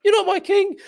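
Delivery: high, loud comic character squawk; hammed-up high-pitched sketch voices; badly done Monty Python bickering. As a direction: act out a silly king-and-peasant argument in falsetto. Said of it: "if I've done that very badly".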